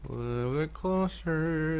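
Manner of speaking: normal talking voice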